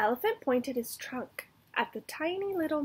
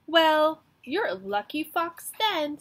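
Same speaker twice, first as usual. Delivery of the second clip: loud, higher cocky taunt; loud bragging; 5 semitones higher, 8 dB louder than usual. Acted. Mouse gloating at the fox, loud. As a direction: lean in and gloat. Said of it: "said the mouse".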